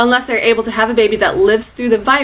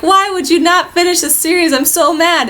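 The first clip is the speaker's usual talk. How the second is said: whiny complaint; 8 semitones higher than usual. Retold or imitated. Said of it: "whined about it".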